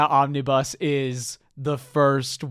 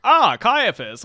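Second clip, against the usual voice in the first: higher-pitched exclamation of recognition; playful pretend greeting; mock greeting; 6 semitones higher, slower than usual. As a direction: greet an old acquaintance in a put-on voice. Imagined character's greeting acted out.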